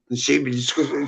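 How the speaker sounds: ordinary talk, nothing marked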